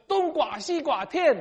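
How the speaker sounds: high, loud vendor's call with skewed Mandarin tones; imitated market shout. Local vendor calling to Chinese shoppers at the market, shouting. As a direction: mimic a local seller hollering in accented Mandarin, drawn out. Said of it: "就用普通话喊着说".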